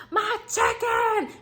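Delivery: high, slow, laughing mock wail of an upset shopper; mock the customer crying over chicken; hyped-up customer. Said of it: "they be like".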